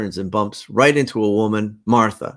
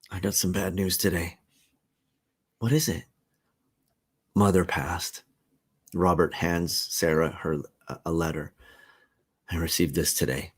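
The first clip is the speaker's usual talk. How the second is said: lower, softer, heavy news; grieving husband; 4 semitones lower, 5 dB quieter than usual. Acted. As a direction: break bad news gently. Robert tells of mother's death.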